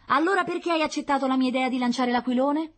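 put-on high, loud child voice; challenging little girl. Sister challenging brother about the kite, high and indignant. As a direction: demand an answer, pitched high.

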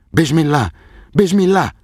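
high, rising alarmed exclamation; shocked cry. A narrator's reading. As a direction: cry out in horror at the sight. Farid rushing up, shocked.

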